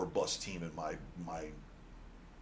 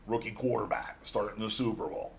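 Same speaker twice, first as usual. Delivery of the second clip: ordinary talk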